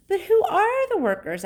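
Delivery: put-on high, loud child's question; high-pitched storybook boy; inquisitive boy. A narrator's reading. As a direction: ask loudly in a high boy's voice. Boy's question voiced in story.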